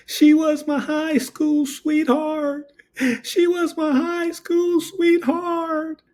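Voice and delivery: high, slow, sobbing lament; mock crying. Old man sobs over his high school sweetheart. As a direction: whimper it out like a heartbroken man. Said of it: "He starts crying".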